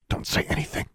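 hushed whispered order. A narrator's reading. Sister silencing Alexandra beside sleeping people, whispered. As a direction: whisper the warning without voicing it. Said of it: "Livia whispered".

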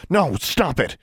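high, urgent cry to stop. A narrator's reading. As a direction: cry out to halt someone. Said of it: "she cried".

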